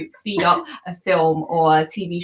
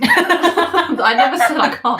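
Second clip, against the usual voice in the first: laughing; 9 semitones higher, 5 dB louder than usual. Real, not acted. Laughing protest about drinking.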